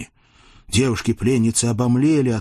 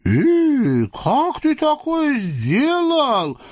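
high, slow, marvelling voice; amazed sultan; much slower than usual. Acted. Sultan marvels at the Cossack's stroke, high and amazed. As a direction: gasp in delight like a spoiled sultan. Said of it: "удивился басурманский владыка".

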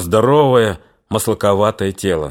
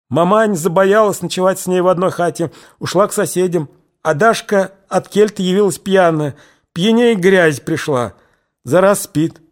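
higher voice, halting and tearful; shaken tearful witness; 8 semitones higher than usual. A narrator's reading. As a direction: blurt it out through tears. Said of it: "коротко, сбивчиво рассказала".

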